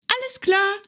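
high cheerful bunny call; calling hare. Acted. Hare calls out to the squirrel, high and cheerful. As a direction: sing it out happily. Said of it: "rief der kleine Hase".

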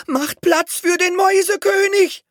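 very high, loud announcement of „Mäusekönig“; laut ausgerufen, hoch; calling out. A narrator's reading. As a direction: herald the king's arrival aloud. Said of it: "riefen Hedi und Biskuit".

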